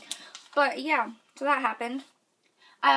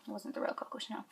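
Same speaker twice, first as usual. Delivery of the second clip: whisper; 14 dB quieter, faster than usual. Real, not acted. Whispered joke aside.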